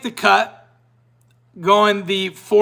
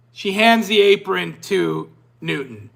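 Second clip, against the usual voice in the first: ordinary talk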